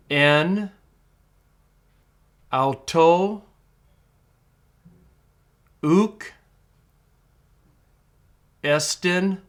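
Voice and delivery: each Greek word said alone, clear and deliberate, with long pauses between; teacher reading Greek aloud one word at a time; careful Greek pronunciation. Shown as a demonstration. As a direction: model Koine Greek word by word so students can repeat. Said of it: "I'm gonna read these verses pretty slowly".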